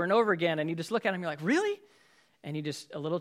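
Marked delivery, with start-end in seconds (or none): 1.4-1.8 much higher incredulous "Really?"; fed up with the spouse's repeat mistake; a spouse at the end of their rope, asking in disbelief; exasperated. Retold or imitated.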